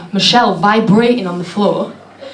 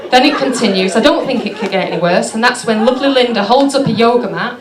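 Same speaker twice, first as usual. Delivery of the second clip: ordinary delivery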